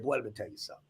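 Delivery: hushed dad voice; low fatherly aside. Retold or imitated. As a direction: lean in and tell the boy quietly. Father lowering voice to son.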